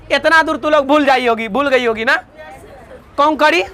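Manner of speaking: normal talking voice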